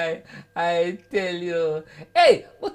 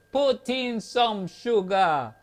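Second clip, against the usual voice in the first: 1 slow punchline; much slower than usual. Retold or imitated. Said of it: "Him say".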